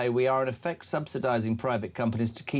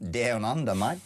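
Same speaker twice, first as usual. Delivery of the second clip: put-on Australian accent. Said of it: "It's Ian from Australia".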